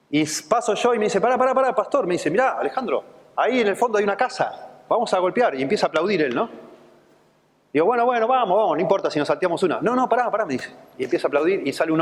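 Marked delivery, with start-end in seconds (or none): 1.2-5.5 put-on high, lively voice of the young convert; eager young convert. Young convert stops the pastor. 7.9-9.8 fast, high and impatient; hurried brush-off. Retold or imitated. Pastor brushes the convert off. 9.8-10.4 high, urgent no no; insistent convert. Retold or imitated.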